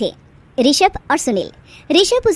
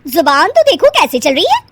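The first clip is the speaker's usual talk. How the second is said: louder, higher threat; threatening sister-in-law; 8 semitones higher, 5 dB louder than usual. Acted. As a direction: put her in her place.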